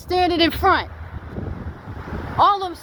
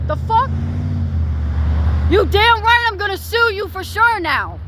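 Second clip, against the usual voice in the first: raised, heated yelling at the mechanics; real angry outburst; 5 semitones higher than usual. Real, not acted. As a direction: blow up at them in real fury. Furious about the ruined tire.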